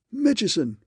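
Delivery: high, loud gasped name. A narrator's reading. Raymond breaking the news on the phone, high gasped outburst. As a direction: blurt the name out in shock. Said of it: "Raymond gasped".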